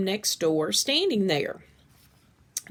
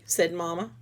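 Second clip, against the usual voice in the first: usual speaking voice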